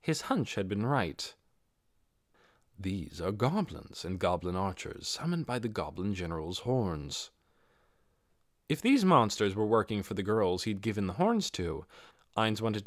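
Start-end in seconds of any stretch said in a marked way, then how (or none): 2.8-7.3 low, soft, measured knight voice; calm armored warrior thinking aloud; Ainz's cool voice. Acted. Ainz identifies the summoned goblins, low and composed.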